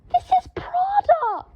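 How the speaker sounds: extremely high, slow shriek. A narrator's reading. Draco waving the waistcoat at Harry, shrieking. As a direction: shriek it with wounded pride. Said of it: "shrieked Draco".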